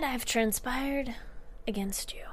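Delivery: hushed, breathy confession of a plot; conspiratorial whisper. Retold or imitated. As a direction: lean in and whisper it like a secret. Sebastian confesses a scheme.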